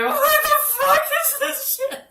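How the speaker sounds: high, slow, laughing yell; laughing shriek. Retold or imitated. Recounting the exam, shrieking with laughter. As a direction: scream it while cracking up.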